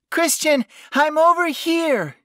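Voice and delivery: loud, very high-pitched call. A narrator's reading. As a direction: shout so he can find you. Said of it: "she called out".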